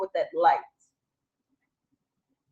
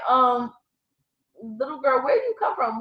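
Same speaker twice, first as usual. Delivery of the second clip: hesitant, higher, a little unsure; timid; 5 semitones higher, 4 dB louder than usual. Retold or imitated. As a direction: ask the strange child gently, half afraid. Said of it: "Just timidly".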